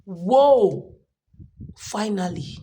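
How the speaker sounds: overjoyed exclamation; joyful shout. Retold or imitated. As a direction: burst out in delight then settle. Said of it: "I was like".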